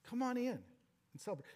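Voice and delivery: father gently pleading with his son, softly; gentle pleading. Acted. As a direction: coax the son inside, softly. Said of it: "he gently pleaded with his son".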